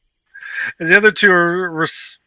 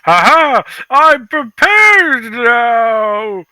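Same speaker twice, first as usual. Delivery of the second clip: gloating villain; 6 semitones higher, 3 dB louder, much slower than usual. Acted.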